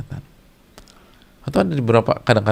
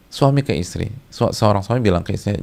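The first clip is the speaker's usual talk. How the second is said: normal talking voice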